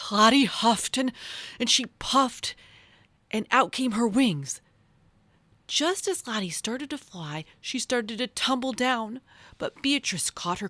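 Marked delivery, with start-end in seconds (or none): none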